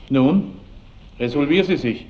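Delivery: stern demand. Acted. Baron demands answer from bride.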